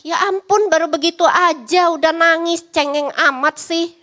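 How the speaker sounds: mock a parent mocking a crybaby; scolding parent. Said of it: "orang tua gini". (orang tua mengejek anak yang menangis)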